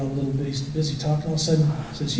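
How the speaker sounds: ordinary talk, nothing marked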